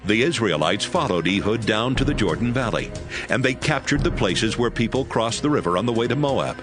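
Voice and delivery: plain reading voice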